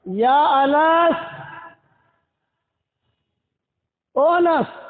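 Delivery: drawn-out call across the neighbourhood. Retold or imitated. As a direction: slowly call the boy from where she sits. Mother calls Anas from afar.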